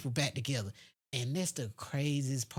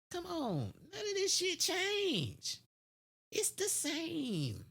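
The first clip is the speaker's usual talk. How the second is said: exasperated; 13 semitones higher, slower than usual. Real, not acted. Exasperated rant about unchanging oppression.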